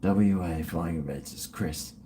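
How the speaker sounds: muttered under the breath to memorise it; softly drilling the name into memory; quiet rehearsal. Shown as a demonstration. Repeating the sponsor name quietly to himself. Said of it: "I've gotta remember Chris".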